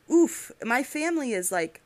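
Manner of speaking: ordinary talk, nothing marked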